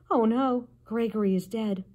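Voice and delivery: ordinary delivery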